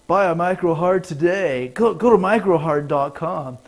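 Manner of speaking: loud mock commercial pitch. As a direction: sell it like a TV ad.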